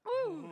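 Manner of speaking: high, loud "ooh" to steady yourself; nervous exhale. Real, not acted. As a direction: blow out the nerves. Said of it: "I'm a bit nervous".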